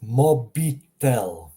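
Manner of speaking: "Mobitel" slowly as MO-bee-tel; Croatian pronunciation. Shown as a demonstration. Teacher reads an example, slow "Mobitel" with ee. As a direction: stretch "Mobitel" syllable by syllable with ee. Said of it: "like I have here".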